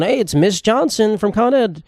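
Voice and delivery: high, slow, sugary customer service voice; phone rep voice. Retold or imitated. Mimicking a customer service rep answering his complaint call, high and slow. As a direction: put on the rep's polite phone voice. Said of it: "You, you call them on the phone".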